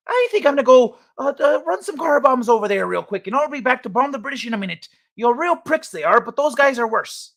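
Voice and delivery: a broad stage Irishman, cheerful and brash; Irish accent. Retold or imitated. Streamer retrying the Irish bit for chat, hammed-up Irish accent. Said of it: "I got something for this".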